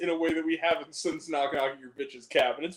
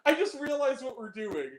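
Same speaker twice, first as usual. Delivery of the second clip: ordinary talk, nothing marked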